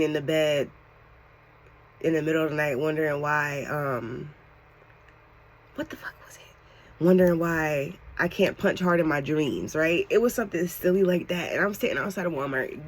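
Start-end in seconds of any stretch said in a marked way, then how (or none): 5.8-7.0 soft, whispered self-questioning; under-the-breath mutter; whispered aside. Real, not acted. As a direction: whisper to yourself while trying to remember. Muttered aside while recalling words.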